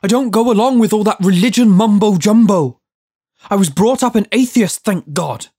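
loud, high-pitched rant; indignant yelling. A narrator's reading. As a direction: keep yelling your objections, outraged. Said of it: "Judd yelled".

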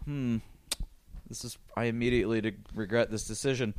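quiet, low deadpan second thoughts; imitated instant regret. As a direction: mumble a mock realization of a mistake. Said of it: "she's all of a sudden sort of like".

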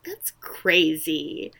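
half-whispered, drawn-out mock disbelief; imitating a stunned, breath-only reaction; hushed disbelief. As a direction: let the last word drop to a whisper. First take of her reaction.

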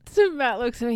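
ordinary talk, nothing marked

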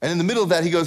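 normal talking voice

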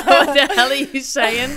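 loud, drawn-out exclamation through laughter; laughing mock bafflement; laughing disbelief. Retelling how she reacts to fast talkers, laughing and baffled. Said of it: "I'm like".

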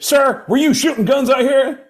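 loud, high mock cop questioning; demanding cop. Cop questions the buddy walking out, loud and demanding. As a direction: challenge him like an officer.

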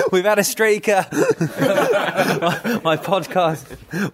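high, giggly and breaking up; laughing while talking. Real, not acted. Host cracking up at his podcast joke, laughing. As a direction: squeeze the words out through laughter.

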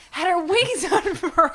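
giddy, high and emphatic; excited. Real, not acted. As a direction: gush it loudly, breaking into a laugh. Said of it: "I'm so excited".

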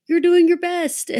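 high-pitched mock consoling squeal; high, gushy pep talk; gushing encouragement. Acting out comforting him with hug.

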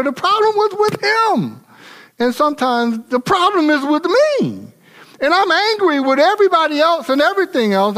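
high, driving pulpit delivery; preaching. Real, not acted. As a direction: press the point home to the congregation. Preaching point at full force.

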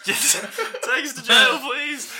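high squealing mock plea; mock pleading. GM jokingly begs the officer to arrest them, squealing. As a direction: beg for jail while laughing.

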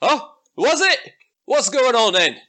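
high-pitched dim ogre voice; startled ogre. Acted. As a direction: play an ogre turning round, puzzled. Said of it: "One of them turns around as you start shouting and goes".

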